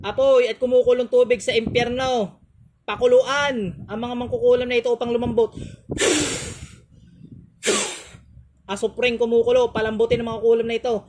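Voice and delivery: ritual command. Acted. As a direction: command the fire and boiling water like a rite. Commanding exorcism invocation. (malakas na utos ng pangontra)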